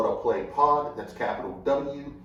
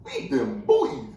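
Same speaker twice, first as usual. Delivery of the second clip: higher, slow, loud mock sports-pundit cheer; Skip Bayless; 14 semitones higher, 5 dB louder, slower than usual. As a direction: ham up a pundit gloating. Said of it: "Skip Bayless in particular".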